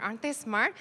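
normal talking voice

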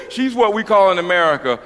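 ordinary delivery

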